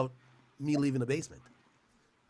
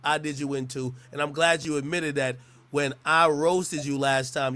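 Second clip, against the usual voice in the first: ordinary talk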